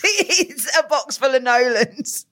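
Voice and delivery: slow, giggling through the words; laughing. Real, not acted. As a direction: laugh while saying it.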